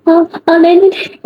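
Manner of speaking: high, giggling protest while being tickled; acted helpless laughter breaking up the words; laughing hard. A narrator's reading. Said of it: "Ananya जोरों से हंसने लगती थी".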